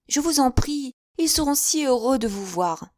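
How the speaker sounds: high, eager, fluttering spinster voice; chattering Miss Bates. A narrator's reading. Miss Bates gushing to her guests, high and eager.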